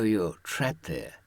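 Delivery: ordinary talk, nothing marked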